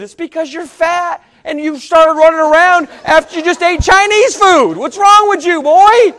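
high, loud, mounting exasperated scolding. Retold or imitated. Preacher mimics berating his queasy friend, scolding loudly. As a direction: build to an incredulous yell at him.

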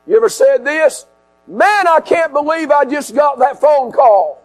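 high-pitched preacher voicing disbelief; impassioned preaching. Preacher voices people hit by sudden bad news, impassioned preaching. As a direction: act out stunned disbelief from the pulpit. Preaches, acting out shocked reactions.

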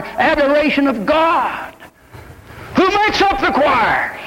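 preaching. Acted. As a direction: lift the church's purpose up to the congregation.

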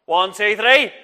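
cue the shout, ramp it up; rising shouted count. Shown as a demonstration. Teacher cueing children's oh no, rising shout. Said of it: "I am gonna shout out and say".

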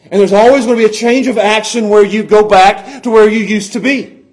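loud, high, slowing preacher's cry; fervent sermon climax, voice cracking with feeling; impassioned preaching. Acted. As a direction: preach it at full force, pleading. Preaching the lesson.

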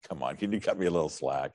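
low, muted plea for some slack; Ezekiel. Acted. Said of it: "And Ezekiel goes".

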